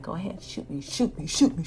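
hushed, smiling dare; sly taunt. Retold or imitated. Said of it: "secretly ... smiling at Vincenzo".

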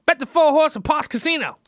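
loud, high grandma order; imitated old lady, loud and high-pitched; demanding grandmother. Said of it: "So she's like".

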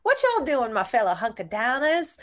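put-on Southern accent. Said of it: "he's got that Southern accent".

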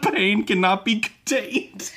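slow, high, giggling through the words; can't keep a straight face. Real, not acted. Reads dramatic line, laughing.

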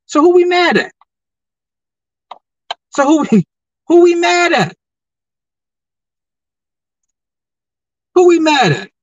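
high, loud repeated challenge; calling out the viewers; loud demand. Real, not acted.

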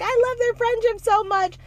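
high-pitched mimicry of adoring fans; put-on fangirl squeal at normal volume; mock gushing fan.

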